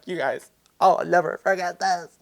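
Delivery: high, overcome thank-you; mock-tearful gratitude. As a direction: gush as if about to cry. Acts out her overwhelmed thanks.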